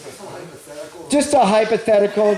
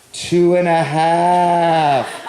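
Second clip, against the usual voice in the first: slow dramatic count; much slower than usual. Retold or imitated. Counting endless seconds slowly.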